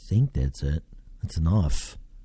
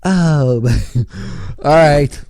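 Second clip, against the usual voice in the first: higher, louder country croon, breaking into a laugh; 6 semitones higher, 5 dB louder than usual. Retold or imitated. Hamming up a country singer for viewers, laughing croon. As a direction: belt out a hammed-up country-singer line.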